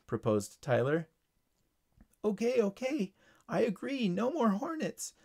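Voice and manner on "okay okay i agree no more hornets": high, small voice giving in; ladybug. Acted. Ladybug agreeing to Tyler's deal, giving in. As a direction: give in quickly, pitched high. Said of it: "said the ladybug".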